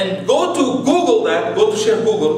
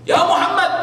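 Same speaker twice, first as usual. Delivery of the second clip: calling out; 8 semitones higher than usual. Retold or imitated. Enemies calling out at cave.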